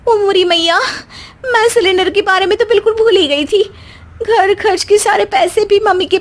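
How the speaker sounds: high, fretful oh my mother; wife panicking over forgotten gas cylinder; panicked dismay. Acted. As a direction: wail in sudden dismay.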